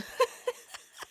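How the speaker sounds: sudden high burst of laughter; laughing burst. Real, not acted. Laughing at a viewer's cheeky comment, loud high burst.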